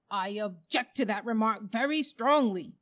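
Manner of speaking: loud, emphatic objection; indignant bulldog. A narrator's reading. Bulldog objects to elephant's remark on noses, indignant. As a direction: protest firmly, with offended force.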